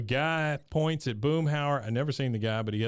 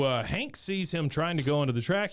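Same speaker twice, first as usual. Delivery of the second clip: ordinary delivery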